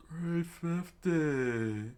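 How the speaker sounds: very slow, high wailing voice; long falsetto cry at normal volume; drawn-out wail. Acted. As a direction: stretch both words into a high moan. Acted character's wail.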